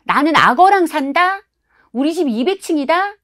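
put-on high, loud, show-off kid's brag; boasting child. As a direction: outdo the friend with a wild tall tale. Kids' bragging battle. (허풍 배틀하는 아이 목소리)

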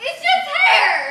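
laughing shout. Acted. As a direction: yell it while cracking up. Haunted house scene, laughing.

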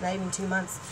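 usual speaking voice

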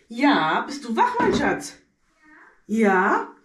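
calling out loud to another room. Real, not acted.

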